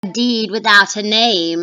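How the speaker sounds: loud, cryptic witch reply. Acted. Witch answering Macbeth, loud and cryptic. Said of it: "This is the third witch".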